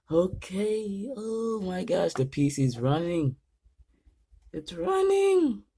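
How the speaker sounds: gasp, then high-pitched excited disbelief; real giddy relief that it works; thrilled amazement. Real, not acted. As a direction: build to a long, very high squeal of joy. PC finally boots, real excitement.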